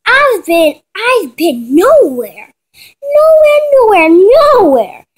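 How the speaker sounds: loud, high, drawn-out protest; insistent denial. Acted. Kayla's acted defensive denial.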